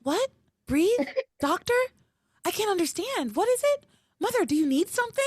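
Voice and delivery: feigning she can't understand; fake innocent daughter. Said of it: "leans down over her and is like".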